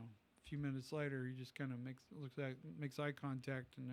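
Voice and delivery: usual speaking voice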